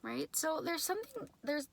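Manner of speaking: normal talking voice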